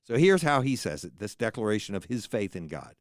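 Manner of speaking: usual speaking voice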